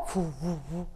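soft, low pulsing whooshes; imitated arterial whoosh. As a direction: imitate blood rushing in a steady, hushed pulse. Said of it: "que es un sonido así".

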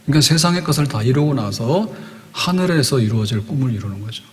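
normal talking voice

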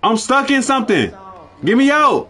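panicking while trapped; panicked. Real, not acted. Stuck, calls for help.

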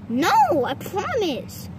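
normal talking voice

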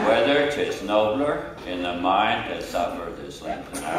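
loud, slow mock film-star recital; John Wayne impression. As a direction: plod loudly through Shakespeare as an imitated Western star. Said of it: "So he went".

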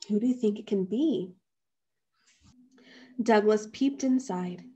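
ordinary delivery, nothing marked

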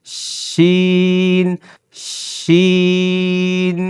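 শিন stretched very long, steady pitch; slow, held শিন for a class; drawn-out shin. Shown as a demonstration. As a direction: hold শিন long like a chant. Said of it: "এর সাথে শিন বলে দিলে হয়ে যাবে".

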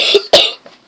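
short dry cough; real coughing fit. Real, not acted. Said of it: "Sorry if all you can hear is me coughing".

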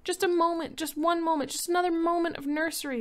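high, loud pleading wail; children wailing to their father for the nursery. Acted. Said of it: "they wailed".

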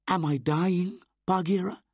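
high, loud anxious plea; scared boy. Acted. Mowgli asking Bagheera if he dies, scared. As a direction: ask fearfully.

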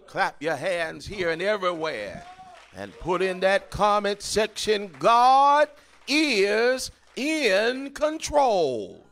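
loud, rising preacher's call; preaching at full force. Acted. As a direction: build it up and lift each phrase. Preaching, calls for response.

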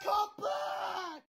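high, distant-sounding call; calling after someone. Acted. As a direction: call out softly from far off, very high.

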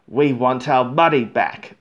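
loud angry crowd demand; loud complaining shout. Acted. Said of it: "they shouted".

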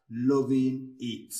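ordinary delivery

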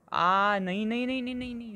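high, rising no no no; high dismayed string of no. Real, not acted. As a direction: cry out as it fails. Last try fails.

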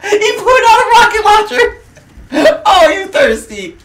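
loud, high, outraged heckling; indignant high-pitched yelling; taunting shouts. Real, not acted. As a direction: holler accusations at the other player. Taunts opponent with rocket launcher.

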